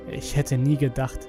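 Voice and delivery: ordinary delivery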